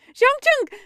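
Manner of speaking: high, loud cry of the name; alarmed cry. A narrator's reading. Companion sees him pulled forward, alarmed high cry. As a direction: call out sharply in alarm. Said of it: "He hears Wei Wuxian's cry".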